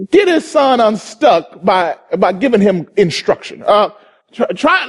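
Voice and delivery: normal talking voice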